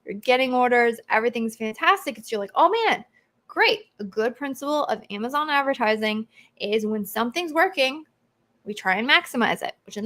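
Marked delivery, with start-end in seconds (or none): 2.5-3.8 high, bright-eyed "oh man, great"; mock delighted seller. Seller mocking easy holiday sales, excited high voice. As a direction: play a seller thrilled with sales.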